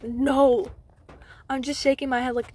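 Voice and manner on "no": loud, emphatic retold no; firm refusal. Patient turning down insistent staffer, firm refusal. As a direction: refuse outright, louder.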